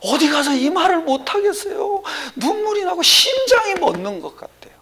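high-pitched imitation of a distressed church member; acted distressed caller. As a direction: voice her complaint high and insistent. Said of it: "그때 뭐라는 줄 알아요". (하소연을 높은 목소리로 흉내)

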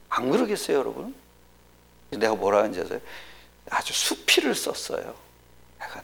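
ordinary talk

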